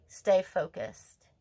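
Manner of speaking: slow, deliberate, each word weighted; slow firm urging. Real, not acted. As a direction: drive it home slowly. Card reading, urging viewer.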